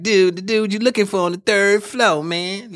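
high, hammed-up snitch voice; stock snitch role. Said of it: "it was like the usual".

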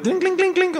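high-pitched clinking Mario coin noise; ping it out like coins in Mario; Mario coin sound. Retold or imitated. Host compares podcast synergy to Mario, high coin clinks. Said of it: "como en el Mario".

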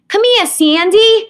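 put-on high, slow, belted Brooklyn kid's Annie line. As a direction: call out like little Annie in Brooklyn. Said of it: "playing Annie as a kid in community theater".

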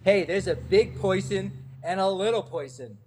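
put-on heavy New York accent, Poison for person; thick New York accent. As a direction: say it like a Brooklyn fan. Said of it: "someone with a thick New York accent saying".

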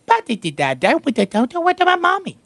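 put-on babbling rising up high like a little boy; lightly voiced toddler asking for mommy; toddler babble. Grandpa imitating the grandson asking where mommy is, babbling. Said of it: "he'll go".

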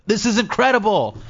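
excited. Retold or imitated. Retold amazement at stand-up.

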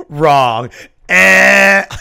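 loud blaring game-show buzzer; loud imitated error buzzer; mock wrong-answer buzzer. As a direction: blurt it like a buzzer going off. Mimics slide's wrong buzzer.

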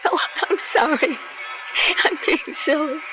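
high, sob-broken apology; small, high weeping voice; tearful apology. Acted. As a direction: apologize while trying to stop crying. Apologizes for crying.

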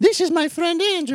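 put-on high, boyish puppet voice; Pinocchio voice. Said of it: "Pinocchio voice".

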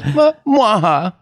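high, punched mock-vampire "mwah, ah"; Dracula judging laugh. Dracula rating the next joke, high mock-evil laugh. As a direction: pop out a cartoon vampire laugh. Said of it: "How about this joke?".

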